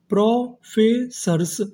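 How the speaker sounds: Professors split slowly into pro, फे, sirs; syllable-by-syllable. Shown as a demonstration. Teacher building professors for students, slow and split. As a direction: draw out each part of professors separately. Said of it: "तो इतनी meaning क्या बनी".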